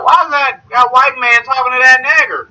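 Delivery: high, indignant small-town caricature; exaggerated high-pitched Southern gawking; mock Southern local. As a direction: imitate a suspicious Southern townsperson. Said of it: "He was like".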